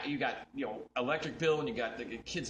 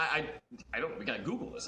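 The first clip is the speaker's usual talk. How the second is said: ordinary delivery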